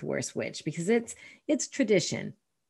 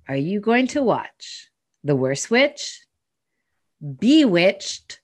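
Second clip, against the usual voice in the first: normal talking voice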